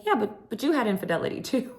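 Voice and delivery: breezy, fast, high-pitched jab; casual Sutton. Retold or imitated. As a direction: toss it off, sweetly savage. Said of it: "Sutton so casually looks at Kyle".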